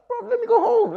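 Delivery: very high, loud squeal of protest; exasperated cry. Retold or imitated. Man begging partner to let him leave, exasperated. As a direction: shoot up into a loud falsetto plea. Escalated plea in retold quarrel.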